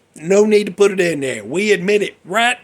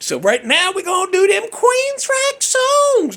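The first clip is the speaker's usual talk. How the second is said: hyped announcement; 4 dB louder, slower than usual. Real, not acted.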